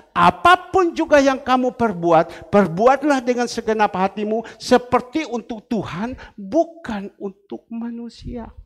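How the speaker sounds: preacher driving home a verse; emphatic scripture reading. Acted.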